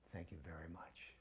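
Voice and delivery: whispered, low thanks; soft reply. Retold or imitated. Prabhupada thanks the boy in a whisper. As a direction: barely breathe the thanks.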